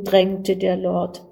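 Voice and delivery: plain reading voice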